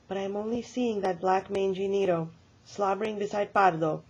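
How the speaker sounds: ordinary delivery, nothing marked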